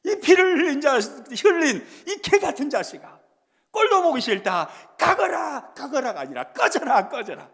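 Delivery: loud shouted insult, fading by the last word; Shimei's angry curse. Retold or imitated. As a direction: yell it at the fleeing king, then let it drop. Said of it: "막 이렇게 하는 거예요". (도망가는 왕에게 퍼붓는 고함)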